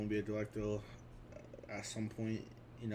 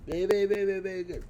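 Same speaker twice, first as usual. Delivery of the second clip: loud, high burst of enthusiasm; gushing praise; 9 semitones higher, 8 dB louder, much faster than usual. Real, not acted. Giving a verdict on The Goonies, gushing loudly. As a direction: gush it loudly, pitched way up.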